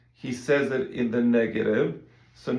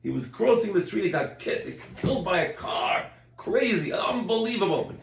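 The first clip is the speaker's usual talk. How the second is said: higher, eager retelling of shocking news; breathless gossip about an accident, raised pitch; excited gossip; 5 semitones higher than usual. Retold or imitated. Rabbi acting a neighbor gossiping about an accident, excited and high. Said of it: "you could say".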